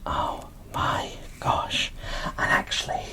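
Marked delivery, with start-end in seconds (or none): none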